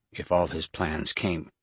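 usual speaking voice

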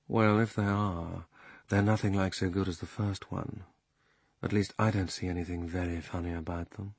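lazy panther. Acted. Panther yawning.

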